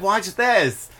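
high, loud goofy comic voice; zany, pleased with himself; a comedian showing off his movies; Jerry Lewis impression. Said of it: "He'd be like".

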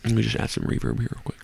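soft ASMR voice while chewing gum. Shown as a demonstration. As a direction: murmur close to the mic, chewing. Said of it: "let me just get some A S M R here".

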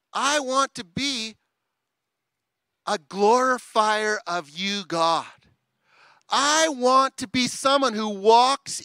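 loud, high, pleading prayer to God; fervent prayer. Acted. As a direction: cry out to God with full force. Preacher voicing prayer to God.

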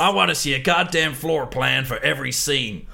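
loud, high mock American accent; over-the-top American accent; broad American accent. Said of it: "broad, probably offensive, uh, American accent".

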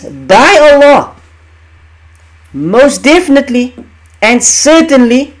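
slow, high, loud emphasis on by Allah; emphatic oath. Shown as a demonstration. Teacher driving home the oath's emphasis, slow and emphatic. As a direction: lean hard on every word of the oath. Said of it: "That's emphasis".

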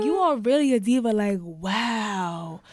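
hammed-up sassy praise; sassy hype woman. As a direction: gush over her like a fan. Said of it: "she's like".

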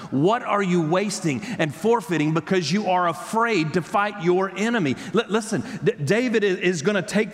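high, emphatic preaching in driving repetitions; impassioned preaching. Real, not acted. Preacher urging congregation to come and serve, impassioned. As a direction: drive each line home, pitched high.

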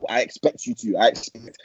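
ordinary delivery

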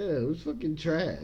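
ordinary talk, nothing marked

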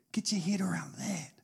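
breathy stage whisper aside. Real, not acted. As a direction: lean in and whisper it. Aside to the congregation, voiceless.

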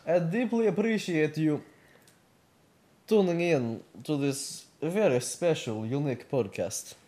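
put-on Russian accent with halting delivery. Podcast host welcoming listeners as Vladimir, Russian accent.

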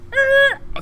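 high, loud screeching "eh"; screech of brakes. Retold or imitated. Both cars cutting to the roadside, screeched sound effect. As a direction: imitate tires skidding to a halt.

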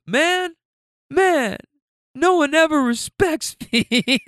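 put-on high, whiny sulking toddler; mock a pouting little kid's complaint, breaking into a laugh; Baby Phil. Toddler told off by aunt and driver, imitated high sulky whine. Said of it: "He looks so pissed".

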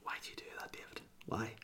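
hushed unvoiced whisper; whispering regret; whispered self-rebuke. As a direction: hiss it at yourself in regret. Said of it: "I go".

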